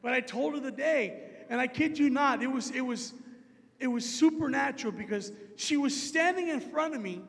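ordinary delivery

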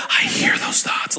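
slow, whispery mind-reader voice; Jesus. Acted. Jesus answers the scribes' secret thoughts, slow and whispery. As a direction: draw it out in an eerie, voiceless rasp. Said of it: "And Jesus is like".

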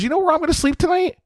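mock heroine. Mocking the heroine's choice.

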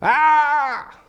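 put-on high wailing child's cry. Preacher imitating a child crying out, high wail. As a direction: wail like a toddler in trouble. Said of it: "what children do when a problem arises".

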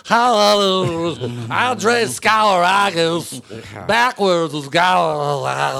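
high, loud, dragged-out announcer voice; Harry Caray impression. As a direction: stretch it like a tipsy old announcer. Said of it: "he would come back in the seventh and be like".

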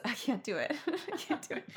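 ordinary talk

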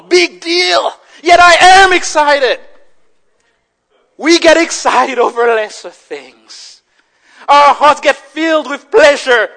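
loud, high exclamations with brief drops; impassioned preaching. Real, not acted. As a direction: challenge the congregation forcefully. Preaches at full force.

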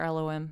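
"L O M" run together as one name, el-oh-em; "L O M" as el-oh-em, ending on a short em; local pronunciation. Shown as a demonstration. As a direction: say "L O M" as locals would, with no h. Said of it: "that's how they would say it there".

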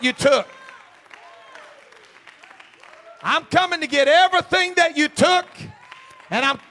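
loud, high, rhythmic shouted preaching; rising call-and-response sermon peak; preaching at full force. Acted. Serving notice on the devil.

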